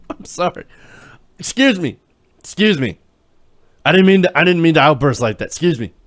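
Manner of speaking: hammed-up sheepish apology imitating Jones; mock Jones apology. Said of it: "feeling sorry for losing his cool".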